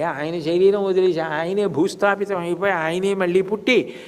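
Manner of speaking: ordinary talk, nothing marked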